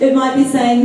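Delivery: ordinary talk, nothing marked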